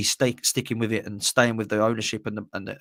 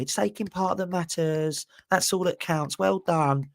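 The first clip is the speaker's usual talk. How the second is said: patronising consolation; 5 semitones higher, slower than usual. Retold or imitated. Mocks fans consoling a losing club.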